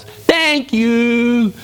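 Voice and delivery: big, high, drawn-out frog voice; wide-mouthed frog. Acted. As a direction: stretch it out with the mouth wide open. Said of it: "the little wide-mouthed frog goes".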